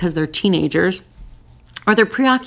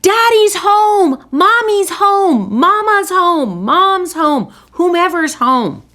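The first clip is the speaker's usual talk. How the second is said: much higher, louder and slow, gleeful calling out; excited shout; 11 semitones higher, 5 dB louder, slower than usual. Pretend. Family greeting a partner at the door, calling out with big excitement. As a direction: cheer the homecoming like a kid. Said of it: "Maybe you can get".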